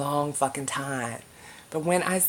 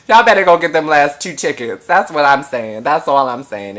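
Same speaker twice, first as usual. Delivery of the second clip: laughing loud urging; 10 dB louder than usual. Real, not acted. Urging fans to buy tickets.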